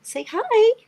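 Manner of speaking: high coaxing pet talk. Acted. Owner coaxes her cat to greet the viewer, high pet voice. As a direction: coax the cat up high, sing-song.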